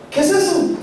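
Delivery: high, baffled uncle voice; confused uncle. Retold or imitated. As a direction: ask it puzzled in high-pitched Spanish. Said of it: "he looks confused".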